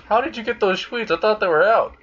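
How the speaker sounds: high-pitched mock disbelief; indignant questioning. Konako confronts Azazel about the sweets, indignant. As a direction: demand an explanation, voice jumping up.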